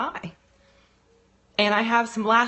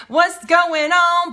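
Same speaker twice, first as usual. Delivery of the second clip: drawn-out greeting; 8 semitones higher, 8 dB louder, much slower than usual. Real, not acted. Greeting a viewer with a slow, stretched singsong. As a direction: stretch it slowly, high and loud.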